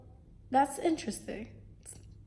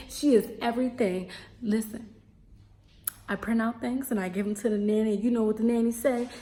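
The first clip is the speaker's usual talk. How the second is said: ordinary delivery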